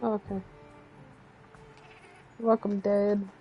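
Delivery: ordinary talk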